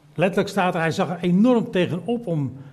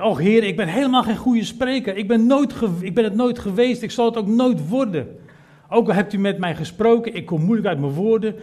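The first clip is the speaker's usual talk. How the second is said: high, raised desperate complaint; an anxious Moses protesting to God; pleading Moses; 6 semitones higher, 4 dB louder than usual. Acted. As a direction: plead you can't speak.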